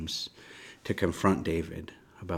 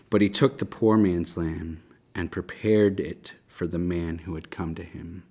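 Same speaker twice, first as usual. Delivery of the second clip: scripture reading; 5 dB louder than usual. Acted. Reads Nathan's parable aloud.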